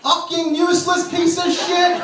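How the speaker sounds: high shrieking mock scream, slow; screaming wife. Wife screaming as husband walks in, a high shrieking mock yell. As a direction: shriek it up high as she hears the car. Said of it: "This is what I hear".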